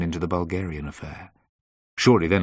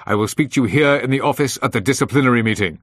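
raised, louder, emphatic insistence; firm and loud; 5 semitones higher, 7 dB louder than usual. A narrator's reading. Boss ordering employee to a disciplinary meeting, firm and loud. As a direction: lay it down loudly and firmly. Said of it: "he said firmly and loudly".

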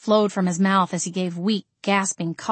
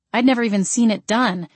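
usual speaking voice

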